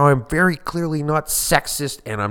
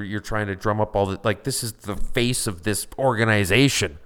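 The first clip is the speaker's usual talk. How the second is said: ordinary delivery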